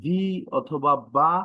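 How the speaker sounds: ordinary delivery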